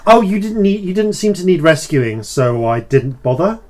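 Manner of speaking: playful high trickster voice; a sly, gleeful fey figure; mischievous goat-horned figure. Acted.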